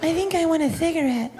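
put-on high little-girl voice, dazed and laughing. Little girl after the broom ride, high and dazed.

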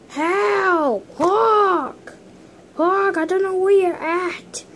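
high, pained cries for help; hurt figure calling. Pretend. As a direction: wail at Hulk, blinded and hurt. Punched figure cries for Hulk.

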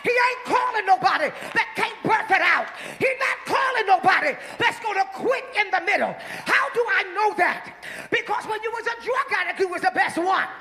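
loud, rising sermon shouts; full-force preaching. Acted. Preacher shouting to the congregation to talk back.

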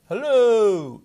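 high, loud drawn-out hello; playful echo-test holler into a hole; calling out. Pretend. Calls out into the engine.

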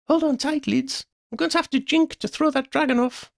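put-on high-pitched urgent girl's call; high, urgent rescuer mid-flight; urgent young friend. A narrator's reading. Friend calls out during dragon chase.

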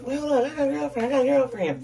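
high, fast mocking guy voice; TikTok guy impression. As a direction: mimic him with a high squeaky voice. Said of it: "the way he says it".

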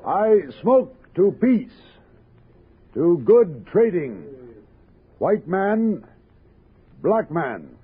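deliberate ceremonial proclamation, pausing between phrases; solemn ceremonial toast. Acted. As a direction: intone each word like a sacred oath. Pipe ceremony toast to chiefs.

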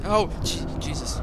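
jolted by a jump scare; startled cry. Real, not acted. Player jumping at a scare, startled loud cry.

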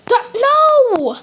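loud, high cry of dismay; shocked cry. Real, not acted. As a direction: caught off guard, yell no. Killed suddenly.

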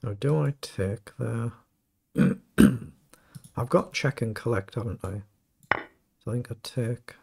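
ordinary talk, nothing marked

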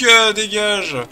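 loud and high, yelling at a car in the way; shouting get out. Real, not acted. Shouting at rival car in race. (crie fort à la voiture qui gêne)

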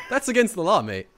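loud, high, through laughter; real laughing. Real, not acted. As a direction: protest while cracking up. Bursts out laughing at cop-killing offer.

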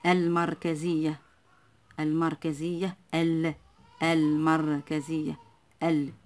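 المركزية slow, with the l of ال clearly sounded; pronounced lam. Shown as a demonstration. Teacher showing the moon lam, المركزية slow with clear l. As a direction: hold the l in المركزية, then isolate ال. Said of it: "إذن اللام تنطق".

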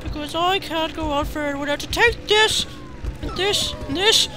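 high-pitched, pleading mock apology. Apologising to creature he puts down.

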